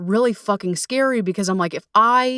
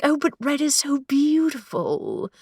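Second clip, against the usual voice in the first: slow, higher, starry-eyed mock swoon; mock dreamy; 6 semitones higher than usual. Mocking her old self admiring red flags, dreamy and slow.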